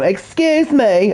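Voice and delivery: slow, high, prissy mock of the father; imitating the father. Streamer to chat, hamming up the father's prim voice. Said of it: "That's what he sounds like".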